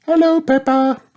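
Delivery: high-pitched little bear greeting; delighted cry. A narrator's reading. Belinda Bear spots her friend Peppa, delighted cry. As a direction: cry out brightly on spotting a friend. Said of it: "cried Belinda Bear, spotting her friend".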